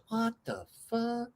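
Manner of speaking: high, slow, stunned disbelief; astonished friend. Retold or imitated. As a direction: stretch it high in amazement. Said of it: "And he goes".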